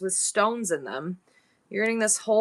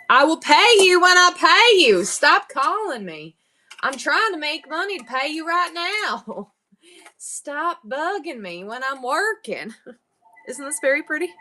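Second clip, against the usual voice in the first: high, hammed-up Southern scolding; mock-exasperated Southern granny; Southern old lady; 5 semitones higher, 5 dB louder than usual. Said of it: "my Southern voice is old lady".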